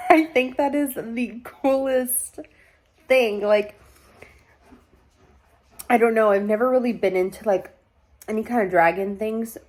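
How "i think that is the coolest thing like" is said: laughing. Real, not acted. Gushing about dragons.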